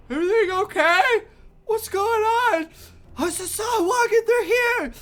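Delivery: high, loud calls from across the harbor; distant shouts. Acted. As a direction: shout out a worried question from far off. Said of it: "shouts out in the harbor".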